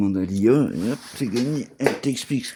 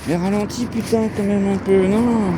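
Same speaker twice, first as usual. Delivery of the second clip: calling out; 10 semitones higher, 6 dB louder than usual. Real, not acted. Telling the dog to slow down.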